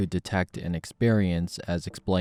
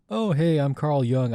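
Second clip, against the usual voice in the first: mock Carl Jung intro; 6 semitones higher than usual. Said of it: "I don't have a German accent".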